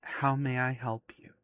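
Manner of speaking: soft, hushed statue voice; statue speaking. Acted. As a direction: speak gently and quietly as an ancient statue. Game master voices the statue.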